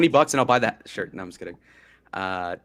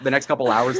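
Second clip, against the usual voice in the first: normal talking voice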